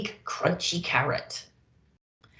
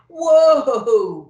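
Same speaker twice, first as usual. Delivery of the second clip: startled shout of whoa; high, loud startled whoa; loud cry; 7 semitones higher, 10 dB louder than usual. Acted. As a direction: cry out as the sleigh jerks. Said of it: "cried Santa".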